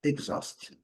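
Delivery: "exhaust" breathed out, starting "egg"; teacher whispering "exhaust"; whispered pronunciation demo. Shown as a demonstration. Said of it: "a gente vai pronunciar egg".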